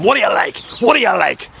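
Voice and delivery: high-pitched indignant shout; imitated shouting. Boy who grabbed him by the throat, shouting. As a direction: yell it as an accusation. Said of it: "this boy started shouting".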